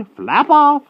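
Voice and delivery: high, loud dismissal; witch's cry. A narrator's reading. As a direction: shriek them away as a witch. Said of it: "cried Madam Mim".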